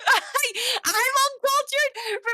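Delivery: high, slow, drawn-out laughing wail; mock wail, laughing. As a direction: whine it like a hammy complaint, laughing. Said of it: "Remember, I'm uncultured".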